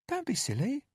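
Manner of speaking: high, sugary caretaker voice; falsely sweet and cajoling; sweet coaxing woman. A narrator's reading. Narrator voices Candy.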